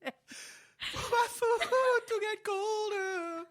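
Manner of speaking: high, very slow whine; mock whiny voice. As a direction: drag it out like a spoiled kid.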